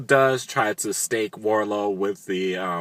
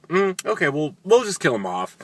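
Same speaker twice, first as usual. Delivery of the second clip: higher, breezy dismissive tone; mock careless writer; 8 semitones higher than usual. The show's writer dropping Warlow, in a breezy mocking voice. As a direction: shrug it off lightly.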